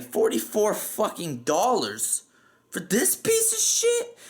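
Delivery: slow mock outrage soaring into a very high-pitched question; overdramatic outrage. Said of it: "over dramatically".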